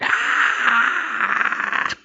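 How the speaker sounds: high, loud cry of pain; pained scream. Acted. Captive's broken hand gets slammed, high acted scream. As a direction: scream as a bone cracks. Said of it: "you hear a crack again, and she's like".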